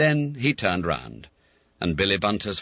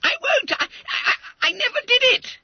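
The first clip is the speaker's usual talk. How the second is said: very high, loud crying howl; 5 dB louder than usual. Acted. Boy afraid of the police, high loud crying howl. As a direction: howl in fear of arrest.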